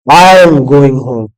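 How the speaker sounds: slow, emphatic "I" at the start; stress on I. Shown as a demonstration. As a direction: hit "I" hard and stretch it. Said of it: "focus on a single word".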